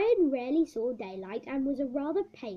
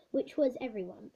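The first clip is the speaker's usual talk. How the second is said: ordinary delivery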